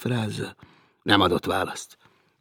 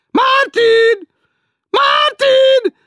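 much higher, loud and slow nagging; shrill teasing woman; 14 dB louder, much slower than usual. Acted. Woman below teasing Martin, shrill and slow. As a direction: drag it out like a shrill landlady.

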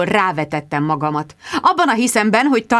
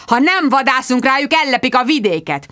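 heated squire; 6 semitones higher than usual. Acted. Squire argues hunting with reporter, heated. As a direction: insist hotly. Sir Hugo arguing.

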